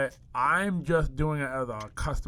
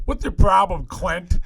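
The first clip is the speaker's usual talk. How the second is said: loud, raised-pitch mock indignation; mock outrage; 5 semitones higher, 6 dB louder, slower than usual. Egging on a customer's complaint from the background, mock outrage. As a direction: pile on with pretend outrage. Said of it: "I'm kinda making fun of your customer".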